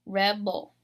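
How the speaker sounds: "Rebel" with power on REB; "Rebel" said REB-ul, weak ending; emphasis on the beginning. Shown as a demonstration. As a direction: punch the first syllable of "Rebel". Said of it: "more emphasis in the beginning".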